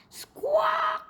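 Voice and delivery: very high squawk like a seabird; a sharp gull-like cry; bird squawk. A narrator's reading. Princess squawks back at the bird, very high squawk.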